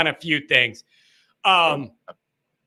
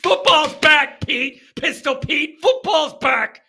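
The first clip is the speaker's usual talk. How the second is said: worked up; 8 semitones higher, 4 dB louder, slower than usual. Real, not acted. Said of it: "I'm getting worked up".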